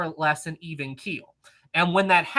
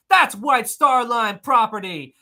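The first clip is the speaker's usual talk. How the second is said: officious steward; 5 semitones higher, 7 dB louder, slower than usual. Retold or imitated. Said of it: "comes up and goes".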